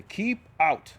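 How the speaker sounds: "Keep Out" punched loud as a warning; emphatic Keep Out. A narrator's reading. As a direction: read "Keep Out" like a stern sign. Sign read out emphatically.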